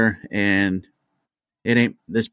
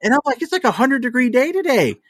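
much higher, incredulous rant; exasperated disbelief. Retold or imitated. To the drunk neighbor: much higher, exasperated disbelief. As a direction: pitch it way up in disbelief at her.

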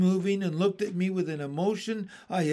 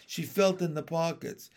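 ordinary delivery, nothing marked

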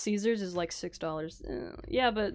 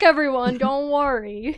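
ordinary delivery

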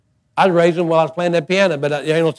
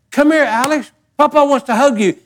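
put-on higher, cheery call to a child; calling the grandson; 8 semitones higher than usual. Grandfather calling grandson for a hug, high and cheery. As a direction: call him over sweetly. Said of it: "I said".

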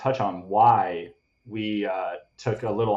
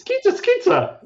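very high squeaky pizza mascot; little pizza guy voice; 4 dB louder, slower than usual. Shown as a demonstration. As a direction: squeal it like a cartoon pizza man. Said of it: "Can you try for me".

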